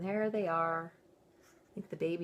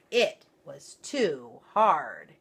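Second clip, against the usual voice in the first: slow, loud, firm delivery; storyteller leaning hard on too hard; hard and heavy; 2 semitones lower, 7 dB louder, much slower than usual. A narrator's reading. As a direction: press each word down like a hard seat. Goldilocks finds the chair hard.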